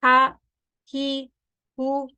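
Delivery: Arabic ha sounds drilled slowly; throat ha demo. Shown as a demonstration. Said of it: "So what does this ha sound like?".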